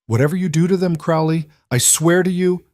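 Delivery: holding back fury, about to break; barely controlled fury. A narrator's reading. Luke warning Crowley over the children, barely controlled fury. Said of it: "barely controlled fury".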